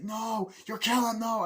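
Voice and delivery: high mock cry of protest; re-enacted desperate plea; imitated horrified plea. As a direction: cry out like a panicking witness.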